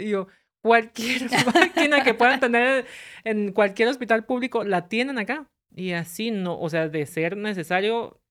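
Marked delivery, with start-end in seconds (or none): 0.6-5.5 high, laughing, insistent question. Retold or imitated. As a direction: ask it half laughing in disbelief. Her insistent question, retold laughing. (pregunta entre risas, aguda)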